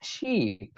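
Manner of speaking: "শিপ" with a long stretched ee, as in sheep; lengthened "শিপ", sheee-p; drawn-out vowel. Shown as a demonstration. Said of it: "টান হবে".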